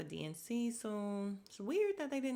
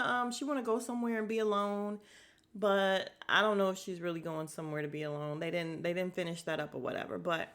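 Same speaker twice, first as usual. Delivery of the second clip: usual speaking voice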